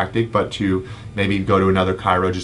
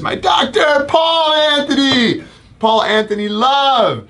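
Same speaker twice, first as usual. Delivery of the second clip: very high, loud drawn-out shout; excited shout-out; 5 dB louder, slower than usual. Real, not acted. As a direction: holler the name slowly with huge excitement. Excited shout-out to a known viewer.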